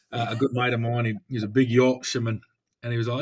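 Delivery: ordinary talk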